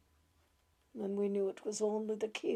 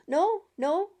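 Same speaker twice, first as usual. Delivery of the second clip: sharp, higher, louder refusal; abrupt; 9 semitones higher, 6 dB louder than usual. Acted. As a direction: cut them off short. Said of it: "she said abruptly".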